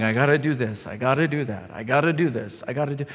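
low, soft grudging mutter of duty; mock weary churchgoer, low and soft; weary chore voice. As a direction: sound dragged down by obligation. Mocking legalistic churchgoer.

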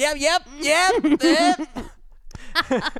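high, strained yeps through pain. Retold or imitated. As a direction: force out a pained yep. Feigning fine while in pain.

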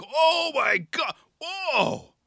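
slow, very high hammed-up wail; mock crowd shock. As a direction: imitate a whole crowd's horrified "oh". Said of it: "everyone's like".

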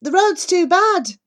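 loud, high shout over an engine; shouting driver. A narrator's reading. Taxi driver shouting to passengers over the engine, loud and high. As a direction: call it out over the roar. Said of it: "shouts the driver over the noise of the engine".

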